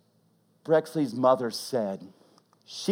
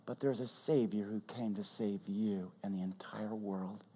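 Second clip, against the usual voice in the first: much softer whispered line; 4 semitones lower, 12 dB quieter than usual. Real, not acted. Preacher telling the congregation of the Savior, whispered. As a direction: lean in and whisper it.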